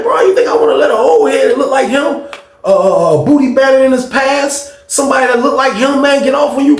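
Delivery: high, worked-up disbelief; indignant protest. Retold or imitated. Teller protests to his roommate, re-enacted indignantly. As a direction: re-enact a heated plea, pitch way up.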